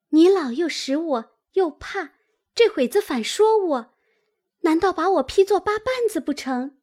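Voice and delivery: high-pitched, aggrieved retort; protesting young maid. A narrator's reading. Maid answers her aunt's nagging, high and aggrieved. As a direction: complain back in a high girlish voice.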